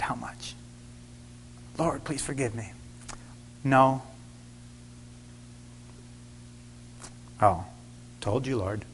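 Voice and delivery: hushed, nearly voiceless plea; whispered prayer. Retold or imitated. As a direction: whisper it to yourself. Retelling his inner prayer.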